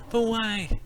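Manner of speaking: a hint of a worried kid; lightly voiced child. Voices the boy David.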